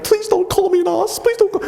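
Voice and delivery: hammed-up frightened plea in a high voice; mock scared pleading. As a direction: beg like a terrified pupil, hammed up. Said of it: "we were all scared".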